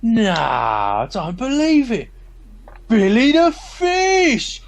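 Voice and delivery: high, loud delighted hail; excited greeting. Acted. Mick hailing his old mate, delighted and loud. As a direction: greet an old friend with big surprise.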